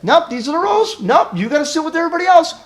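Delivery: high-pitched, dismissive rule quoting; mock officious no; rigid refusal. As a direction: shut them down by the rulebook. Acted rigid refusal by rule keeper.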